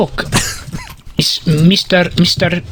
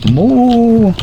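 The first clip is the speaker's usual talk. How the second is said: long, higher pretend moo; cow mooing; 3 semitones higher, 4 dB louder than usual. Man showing guys his cow, long mooing. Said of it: "He's saying moo".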